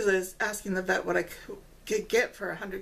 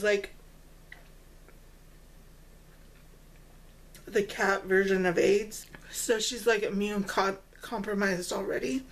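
muffled behind hand; talking while chewing. Real, not acted. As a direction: mouth covered by palm. Seen 1: Hand covers mouth after eating snack; jaw hidden behind hand.

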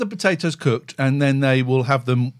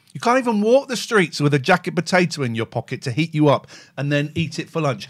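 usual speaking voice

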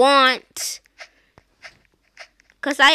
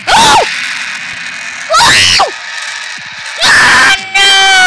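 high shrieking panic; panicked screams; 10 dB louder, much slower than usual. Real, not acted. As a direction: scream in terror. Caught in game.

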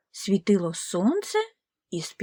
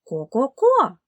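a loud, higher cluck; louder hen clucks; 4 semitones higher, 5 dB louder, slower than usual. Acted. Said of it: "закудахкали кури".

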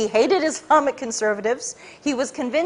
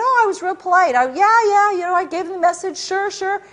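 mock polite self; 7 semitones higher than usual. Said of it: "I said".

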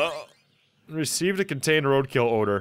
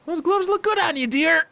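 much higher, sing-song voice for the compliment; put-on character voice; 13 semitones higher than usual. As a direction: coo it sweetly in a high character voice. Voicing a game character's line.

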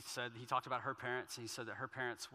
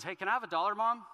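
put-on higher, louder kid's request; child asking mom; 8 semitones higher, 9 dB louder than usual. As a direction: pipe up like a kid wanting something from Mom.

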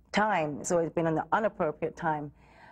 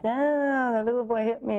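put-on whiny child; 6 semitones higher, 4 dB louder than usual. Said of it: "I wasn't gonna run home like".

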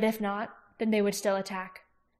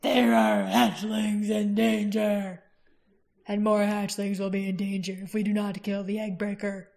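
ancient dragon, grave and urgent. A narrator's reading. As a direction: speak like an old dragon pressing a warning. Narrator voices dragon.

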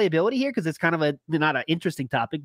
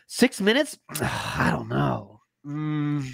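mock reluctance; 4 semitones lower, much slower than usual. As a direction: act doubtful, dragging out a low sigh. Acting out reluctant viewer, sighing.

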